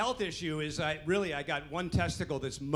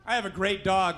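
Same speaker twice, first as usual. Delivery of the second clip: ordinary talk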